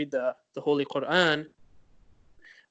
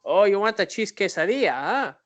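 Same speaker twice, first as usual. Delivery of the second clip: clumsy mock Spanish accent, a bit louder; overdone Spanish-accented reply from a random guy; failed Spanish accent; 3 semitones higher, 5 dB louder than usual. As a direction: imitate someone badly faking a Spanish accent. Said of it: "at a Spanish accent".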